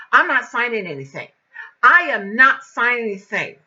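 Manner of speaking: loud, emphatic refusal; indignant, forceful declaration; angry refusal. Retold or imitated. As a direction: put your foot down, loudly. Retold refusal during quarrel.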